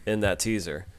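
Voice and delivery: usual speaking voice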